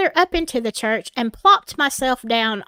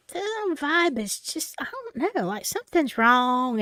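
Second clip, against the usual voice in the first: quiet unsettled private thought; uneasy inner thought; 2 semitones higher, 6 dB quieter than usual. Retold or imitated. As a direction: think it quietly, unsure.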